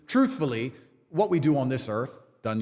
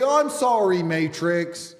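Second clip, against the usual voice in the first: hammed-up whimpering sorry with drawn-out words; stretched-out mock crybaby plea; mock tearful apology; 5 dB louder, slower than usual. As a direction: fake a sobbing, grovelling apology. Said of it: "cry my eyes out on cameras".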